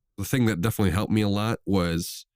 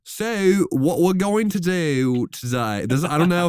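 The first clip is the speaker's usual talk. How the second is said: lightly put-on Australian accent, higher and slow; mock Australian accent; 9 semitones higher, 4 dB louder, slower than usual. Co-host attempting an Australian accent on request. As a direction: try a hint of Australian. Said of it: "learn an Australian accent".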